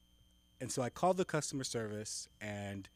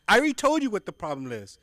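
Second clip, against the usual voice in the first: louder, higher, exasperated; irritated retold complaint; 7 semitones higher, 10 dB louder, faster than usual. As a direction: snap at the agent. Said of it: "frustrated caller".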